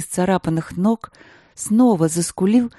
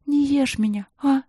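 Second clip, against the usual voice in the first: soft, high frightened plea; quiet pleading; 8 semitones higher than usual. Acted. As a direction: beg the bear quietly. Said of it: "попросила она тихо".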